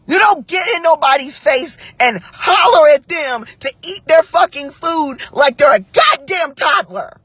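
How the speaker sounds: high, heated scolding; angry reprimand. Real, not acted. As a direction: lay down the law, outraged. Angry rule after retold incident.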